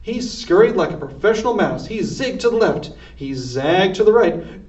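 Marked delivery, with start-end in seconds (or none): none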